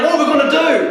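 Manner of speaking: loud, high mock panic; freaking out. The servant facing the enemy troops, freaking out. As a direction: cry it out in exaggerated alarm. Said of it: "he's freaking out".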